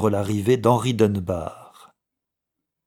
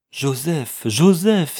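higher-pitched exclamation, louder on the repeat; astonished cry; 7 semitones higher, 6 dB louder, slower than usual. A narrator's reading. Old clerk recognising his lost brother, astonished cry. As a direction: cry the name in shocked recognition. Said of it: "s'écria le vieux commis".

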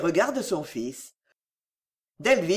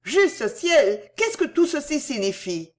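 irrepressible exclamation; 7 semitones higher, slower than usual. A narrator's reading. Cécile to Delville as she leaves, unable to hold back. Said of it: "ne put s'empêcher de dire".

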